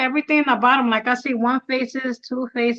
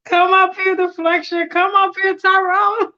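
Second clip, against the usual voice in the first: higher and louder, calling them up; 6 semitones higher, 4 dB louder than usual. Real, not acted. Host calling Deflection and Tyrone onto the stream, louder and higher. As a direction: call them up to the stage.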